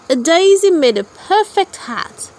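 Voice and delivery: usual speaking voice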